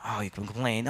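normal talking voice

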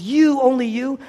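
high, loud, pointed appeal; emotional calling out. Acted. Jesus weeping over the city, calling out emotionally. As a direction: call them out, voice lifted on each you. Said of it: "he's calling you out".

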